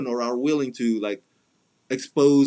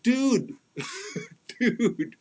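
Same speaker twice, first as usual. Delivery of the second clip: incredulous, pitched-up dude with a laugh; exasperated dude; 10 semitones higher than usual. Real, not acted. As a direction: laugh in disbelief at all the fuss. Exasperated at fans' fuss.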